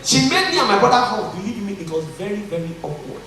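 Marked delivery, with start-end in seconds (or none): 2.5-3.2 understated aside. Real, not acted. Speaker admitting discomfort at hugging his brother, understated. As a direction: softly let "very awkward" drop. First take of repeated phrase.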